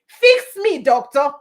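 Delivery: high, loud plea to the doctor; pleading patient. Retold or imitated. Patient begging the doctor for help, high and loud. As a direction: beg the doctor to fix you. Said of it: "we go to them and say".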